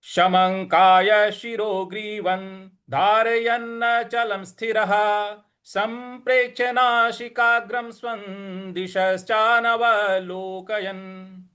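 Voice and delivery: slow even chanting of verse; full verse recitation. Shown as a demonstration. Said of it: "इस प्रकार से हो जाएगा". (पूरा श्लोक पाठ)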